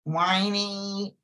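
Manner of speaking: slow, drawn-out whiny impression; Ron DeSantis, whiny. Co-host mimicking how the governor talks, whiny. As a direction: whine it slowly, stretching out the words. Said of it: "kinda talks like this".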